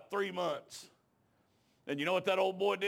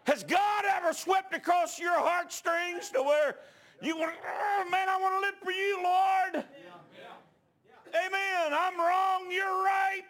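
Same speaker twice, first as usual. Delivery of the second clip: high, loud, slow shouted preaching; preaching at full force; 11 semitones higher, 3 dB louder than usual. Acted. Preacher urging the congregation to answer, in full shouted fervour. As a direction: build the altar call to a roar.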